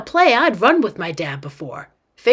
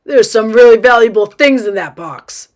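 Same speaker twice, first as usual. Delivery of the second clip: loud, urgent admonishment; dad's warning; 9 dB louder than usual. Acted. Dad warns girl about valuable box, loud warning. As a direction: warn loudly, protecting your belongings. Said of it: "he warned her".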